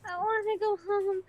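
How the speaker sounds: panicked crying plea. Retold or imitated. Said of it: "I'm like".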